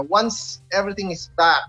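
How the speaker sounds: usual speaking voice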